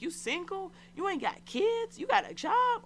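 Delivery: high-pitched, rising questions; incredulous squeaky-high quizzing; mock interrogation. Retold man grilling her with questions.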